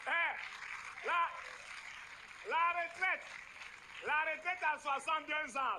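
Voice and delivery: booming mock decree; mock proclamation. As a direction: proclaim it to the crowd, drawing out the words. Acted booming political announcement.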